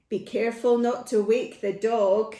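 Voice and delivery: ordinary delivery, nothing marked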